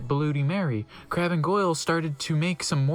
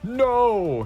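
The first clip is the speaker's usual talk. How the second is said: crude laugh; 6 semitones higher, 4 dB louder than usual. A narrator's reading. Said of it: "he laughed crudely".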